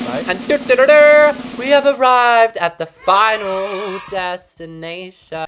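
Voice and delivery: high, loud, slow grand reveal; fanfare announcement. Pretend. Announcing the final room to viewers as a loud, high fanfare. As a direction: trumpet it like a game-show reveal.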